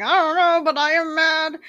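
high, loud mock-angry outburst; mock mad yell. Answering husband, high loud mock anger. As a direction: snap it out high and loud, half joking. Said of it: "I'm like".

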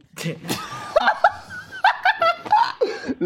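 high squealing laugh while tickled; ticklish laughing. Real, not acted. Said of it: "Nicht kitzeln".

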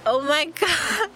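high, slow oh my God; stunned at the discovery; drawn-out high disbelief; shocked. Real, not acted. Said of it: "I'm gonna, like, literally scream".

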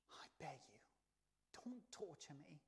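soft, half-whispered plea; acted timid, hushed begging. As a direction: plead quietly, as if cowering. Demons pleading with Jesus.

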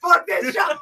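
loud, high, laughing yell of defiance; gleeful shout. Retold or imitated. Quitter yelling at her old job, loud and laughing. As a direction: shout it out as you drive away.

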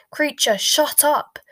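exasperated yell to silence someone; shouted command. A narrator's reading. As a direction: shout the elf down. Harry silencing the screaming elf.

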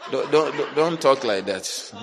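ordinary talk

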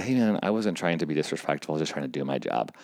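soft, conciliatory aside; quiet placating. Retold or imitated. Helper pulls director aside to explain, softly placating. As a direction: imitate calmly smoothing things over, voice low-key.

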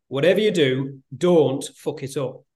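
lightly put-on Yorkshire accent, deadpan. Son retelling his dad's blunt advice, mock Yorkshire accent. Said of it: "he said in his Yorkshire accent".

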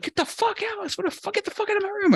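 high, strained mock freakout; flipping out on the girl in his room, high and frantic. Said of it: "I flipped out on her".

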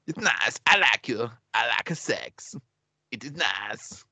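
comic mock-Kazakh accent; hammed-up Kazakh accent impression; Borat. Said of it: "I used to do a really good, um, Borat one".

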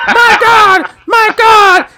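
high, shouted commentator impression; J R's call. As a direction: yell it like a stunned wrestling announcer. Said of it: "you got J R's call".

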